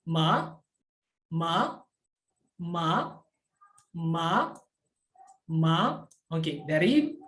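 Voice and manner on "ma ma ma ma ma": MA as a rising Mandarin second tone. Shown as a demonstration. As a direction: start MA low and sweep it upward. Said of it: "dari nada rendah ke nada".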